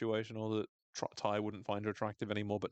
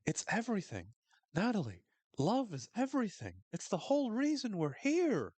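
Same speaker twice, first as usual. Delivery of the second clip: mock-earnest speech swelling to a rapturous peak; earnest Coach; 7 semitones higher than usual. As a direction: preach love like a tripping coach. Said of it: "Coach is like".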